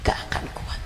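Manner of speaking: hushed whisper. Acted. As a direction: whisper it after the shout. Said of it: "bisikan setelah teriakan". (bisikan pelan penuh makna)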